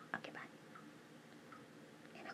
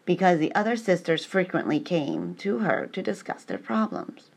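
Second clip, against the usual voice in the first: reading aloud; 19 dB louder than usual. Acted. Reads a text aloud loudly.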